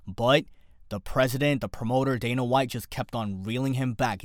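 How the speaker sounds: normal talking voice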